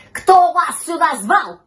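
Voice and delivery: slow, loud, high demand; drawn-out angry cat shout; cat yelling. A narrator's reading. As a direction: shout an angry challenge at intruders. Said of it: "закричал кот щенку и котёнку".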